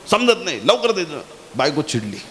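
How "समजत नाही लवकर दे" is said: high-pitched, impatient mock order; mock yell. Husband hurrying his wife along, mock yell. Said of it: "बायकोला ओरडला एकदम".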